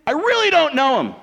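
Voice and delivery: high, loud, vehement outburst; acted vehement denial; swearing denial. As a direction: swear it at the top of your voice. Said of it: "say that Peter swore".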